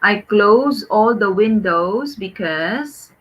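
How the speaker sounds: slow model correction. Shown as a demonstration. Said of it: "can you make a sentence?".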